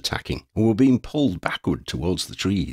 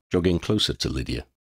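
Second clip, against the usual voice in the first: usual speaking voice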